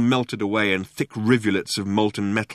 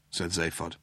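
ordinary delivery, nothing marked

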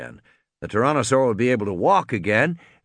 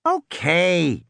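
grudging sigh; 6 semitones higher than usual. A narrator's reading. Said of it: "she sighed".

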